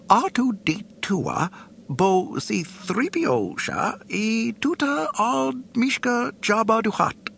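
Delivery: prim robot speaking Huttese; fussy droid. A narrator's reading. C-3PO speaks alien language.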